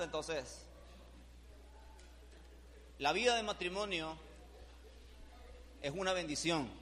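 ordinary talk, nothing marked

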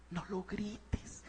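whispered "no lo grites", slow and breathy; whispered advice. Shown as a demonstration. Preacher showing a mother how to speak softly, whispered. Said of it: "háblale con calma".

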